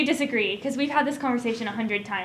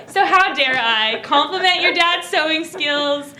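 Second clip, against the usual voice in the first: ordinary talk, nothing marked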